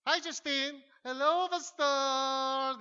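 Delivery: high, loud, drawn-out fake friendly hello; fake cheerful greeting. Pretending to be glad to see the pastor, hammed-up fake cheer. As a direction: put on a sugary show of friendliness. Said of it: "Kukunwari ka".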